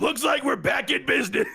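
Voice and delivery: gleeful cartoon old-man shout; Grunkle Stan voice. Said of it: "for his Grunkle voice".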